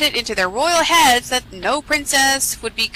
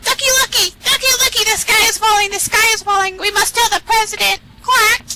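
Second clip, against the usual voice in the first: very high, frantic hen voice; high-pitched panicky chicken; clucked chicken alarm; 11 semitones higher, 4 dB louder than usual. Acted. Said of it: "and clucked".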